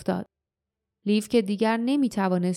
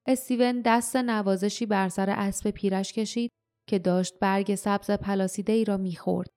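ordinary delivery

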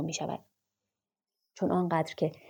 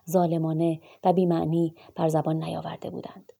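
ordinary delivery

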